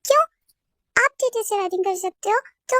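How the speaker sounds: usual speaking voice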